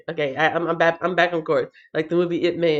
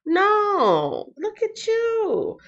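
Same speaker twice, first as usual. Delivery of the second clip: teasing praise; 14 semitones higher, much slower than usual. Real, not acted. Praising a viewer in chat.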